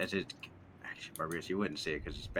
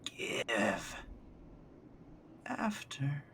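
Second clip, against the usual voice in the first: eerie whisper; 7 semitones higher, 5 dB quieter, much slower than usual. Acted. Skinwalker speaks.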